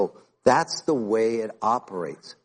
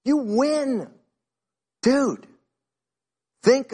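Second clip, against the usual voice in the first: high, loud, soaring exhortation; fired-up preaching; 12 semitones higher, 4 dB louder than usual. Acted. Pastor urging a doubting churchgoer, fired-up and high. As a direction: preach it out with full fire.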